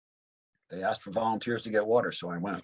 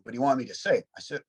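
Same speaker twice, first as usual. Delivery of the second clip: ordinary talk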